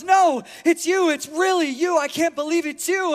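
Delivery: imitated starstruck gushing, high and loud; excited fan. Woman insisting she has spotted a celebrity, excited. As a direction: play a fan who can't believe it. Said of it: "jumping up and down and hyperventilating".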